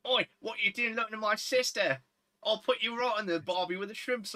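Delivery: mock Australian accent. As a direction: play an annoying Australian brother confronting someone. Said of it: "an Australian accent".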